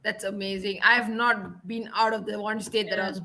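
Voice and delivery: ordinary talk, nothing marked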